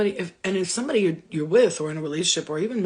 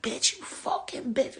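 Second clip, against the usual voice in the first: hissed, slow and breathy, quieter; nasty insult; 5 semitones higher, 4 dB quieter, slower than usual. Retold or imitated. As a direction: spit it out with venom under the breath. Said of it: "I'm not like".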